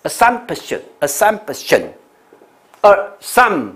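assumption slow and high, pausing after SUM; careful, broken-up model of assumption; unreleased p. Shown as a demonstration. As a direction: stop briefly inside assumption without sounding the p. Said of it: "这个顿一下，p不出音".

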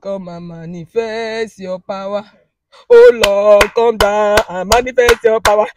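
pleading prayer chant, rising in force; fervent prayer. Real, not acted. Woman pleading with God in prayer. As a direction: pray it out, begging for power.

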